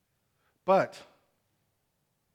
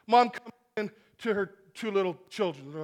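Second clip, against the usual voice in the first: usual speaking voice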